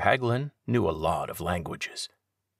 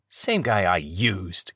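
slow, cutting retort; snapped back; slower than usual. A narrator's reading. Chief snapping back at Webb, slow cutting retort. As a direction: bite off each word at him. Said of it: "Kale snapped back".

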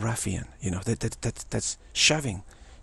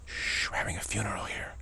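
hushed whispering shh; shushing whisper; 3 semitones lower than usual. Retold or imitated. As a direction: hush the room in a whisper. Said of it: "He said".

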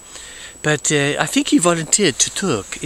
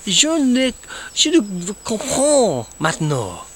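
French; 5 semitones higher, slower than usual. Acted. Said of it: "French phrase in character".

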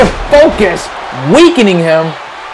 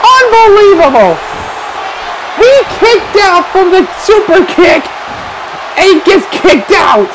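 excited commentator yell; 8 semitones higher than usual. Acted. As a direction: lose it over the kick-out. Kick-out surprise, shouting commentary.

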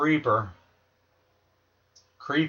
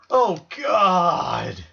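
high crying shout of ecstasy; mock orgasm cry; 6 semitones higher, 3 dB louder than usual. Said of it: "Sound like you just orgasmed it".

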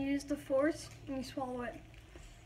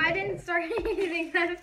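laughing; 4 semitones higher, 8 dB louder than usual. Real, not acted. Laughing denial.

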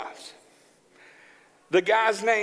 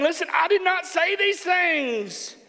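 slow, raised, near-shouted protest; emphatic denial; 7 semitones higher than usual. Retold or imitated. Paul angrily denying the rumors.

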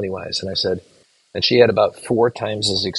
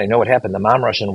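usual speaking voice

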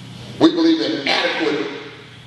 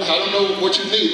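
ordinary talk, nothing marked

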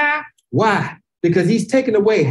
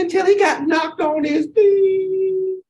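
high, slow, crying-out preaching; emotional sermon climax; fervent preaching; 9 semitones higher, slower than usual. Real, not acted. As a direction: cry it out to the congregation. Preaching Paul's conversion.

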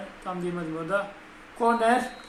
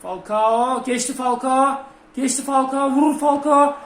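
commentary climbing in pitch and volume; building excitement; 4 semitones higher, 4 dB louder, slower than usual. Real, not acted. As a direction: build suspense toward the shot.

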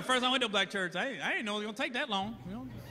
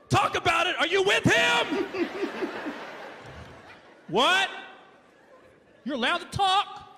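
high, loud mocking call-outs; sarcastic outburst; 10 semitones higher, 4 dB louder, slower than usual. Annoyed churchgoer mocks the pews in a loud, high voice. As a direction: throw their shouts back at them. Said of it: "I'm getting upset".

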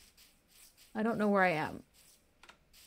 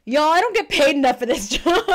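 laughing mock complaint; 3 semitones higher, 5 dB louder than usual. Real, not acted. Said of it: "Just kidding".

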